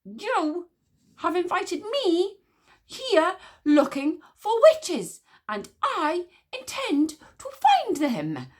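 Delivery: high, loud slow declaration; shout. Acted. Witch smeller shouts back at the queen, high and loud. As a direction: proclaim it to the whole courtyard. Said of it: "shouted the witch smeller".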